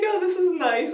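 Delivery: high-pitched polite relative; mild family imitation.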